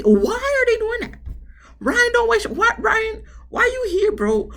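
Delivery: high, loud outraged yelling; exasperated shout. Real, not acted. Host yells at Ryan on the preview, high exasperated shouting. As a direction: yell at the screen in disbelief.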